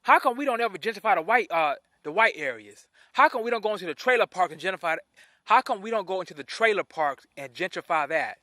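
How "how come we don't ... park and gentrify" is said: fast, run-together rhetorical question; rapid challenge. Real, not acted. Host challenges viewers about trailer parks, rapid challenge. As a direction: fire the question off quickly. First, rushed take of question.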